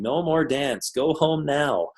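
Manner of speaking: high, small, slow plea; tearful toddler. Retold or imitated. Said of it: "in tears, saying".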